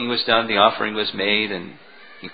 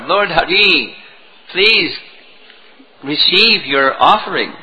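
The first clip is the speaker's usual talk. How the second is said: raised, higher voice calling out a prayer; calling out to the Lord; 4 semitones higher, 4 dB louder, slower than usual. Acted. Brahmin calling to his deity to accept the offering, calling out. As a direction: call out to the deity with a lifted voice. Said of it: "he called".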